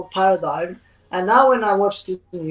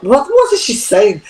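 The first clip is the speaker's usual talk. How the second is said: higher-pitched mock questioning; imitating baffled acquaintances; 4 semitones higher, 4 dB louder than usual. Voicing what people who know her would think, mock bafflement. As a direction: lightly act out baffled people, pitch raised. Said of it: "they're gonna think".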